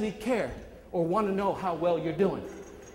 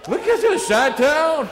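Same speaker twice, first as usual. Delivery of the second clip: loud, high hype shout; loud, high call to hometown fans; excited shout-out; 7 semitones higher, 9 dB louder, slower than usual. Real, not acted. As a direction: hype up the Chicago crowd. Hyping crowd from Chicago.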